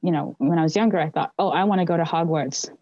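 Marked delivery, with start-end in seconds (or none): none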